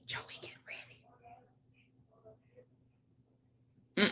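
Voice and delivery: breathy whisper, no voice; whispered tease. Shown as a demonstration. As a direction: hush it like a secret. Whispered repeat of a comment.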